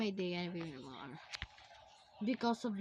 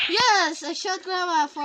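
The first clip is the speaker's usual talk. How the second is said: loud, raised victory cry; triumphant shout; 5 semitones higher, 11 dB louder than usual. Real, not acted. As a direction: crow over a successful shot. Triumph at shooting Grandma.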